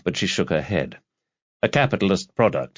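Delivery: usual speaking voice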